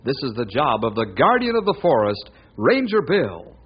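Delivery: punchy announcer voice over music; announcer intro. Acted. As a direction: sell the hero's adventures like an old radio announcer. Radio show opening announcement.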